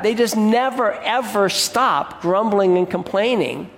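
usual speaking voice

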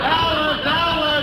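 high-pitched cry for his wife; panicked yell. Acted. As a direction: yell out for help, high and drawn out. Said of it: "Why are you yelling".

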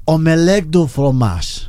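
slow French accent demo. Shown as a demonstration. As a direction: say "Omelette du fromage" slowly with French flair. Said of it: "con toda la intención y con todo el acento". (acento francés lento y marcado)

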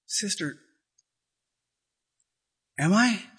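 timid question. Retold or imitated. Girl asks timidly.

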